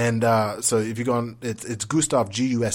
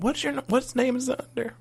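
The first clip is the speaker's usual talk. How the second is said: clerk's question. Retold or imitated. Will call clerk asking name.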